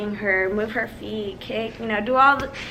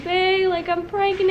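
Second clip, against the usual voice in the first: high, whiny mock-tearful voice; hammed-up weepy phone call, pitched high; imitated crying; 6 semitones higher than usual. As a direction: re-enact breaking the news through tears. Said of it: "I'm, like, still crying".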